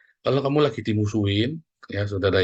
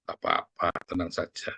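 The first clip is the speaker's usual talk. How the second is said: mock hushed soothing; 5 semitones lower, 8 dB quieter than usual. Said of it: "itu di situ kamu ngomong".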